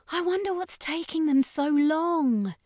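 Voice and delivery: a fretful, high-pitched gripe; high complaining whine. A narrator's reading. Ashley complaining about the wait, high and whiny. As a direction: whine it, impatient. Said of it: "she complained".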